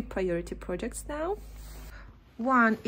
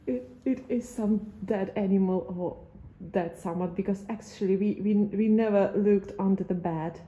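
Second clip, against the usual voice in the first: usual speaking voice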